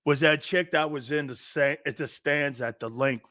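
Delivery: ordinary talk, nothing marked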